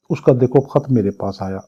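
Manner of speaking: ordinary talk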